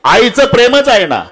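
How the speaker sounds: high-pitched, insistent kirtan oratory; impassioned preaching. Real, not acted. Preacher showing the congregation a father weeping alone, impassioned. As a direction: build the picture in mounting, pleading phrases.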